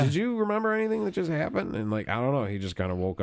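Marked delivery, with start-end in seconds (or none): 0.0-1.8 asked much higher, still rattled; startled question. Retold or imitated. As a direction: check on him, still shaken. Asking the friend after the episode.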